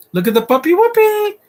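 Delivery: high squeaky cartoon voice, loud; excited high squeal at the puppy; silly cartoon voice.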